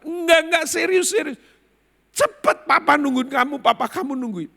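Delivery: high, loud pleading urgency; urgent insistence. Retold or imitated. Employee swearing the father really is waiting, urgent. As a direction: insist at the top of your voice.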